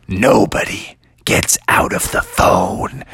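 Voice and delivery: low, slow villain voice; deep, drawn-out voice of doom; ominous weatherman. Acted. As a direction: draw it out low and menacing. Weatherman's ominous answer in the story.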